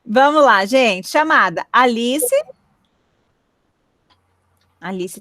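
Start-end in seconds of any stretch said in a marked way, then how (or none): none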